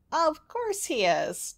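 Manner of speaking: slow, high, amused witch voice; chuckling witch. A narrator's reading. Unseen witch agreeing with the princess, chuckling. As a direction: draw it out high with a hint of a chuckle. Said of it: "chuckled a voice".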